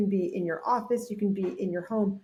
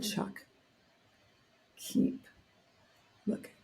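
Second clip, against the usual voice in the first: soft coaching murmur; 8 dB quieter than usual. Retold or imitated. Gentle coaching instruction retold.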